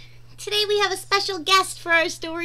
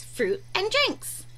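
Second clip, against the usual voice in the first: usual speaking voice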